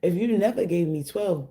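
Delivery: ordinary delivery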